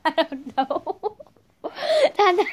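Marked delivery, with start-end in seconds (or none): 1.6-2.5 laughing loudly. Real, not acted. Laughing then loud recitation.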